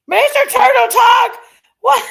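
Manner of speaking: joyful shout at a generous viewer; excited shout. Real, not acted. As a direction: yell his name in happy surprise. Surprised thanks to a viewer.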